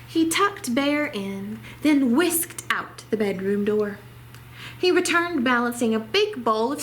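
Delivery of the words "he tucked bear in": ordinary delivery, nothing marked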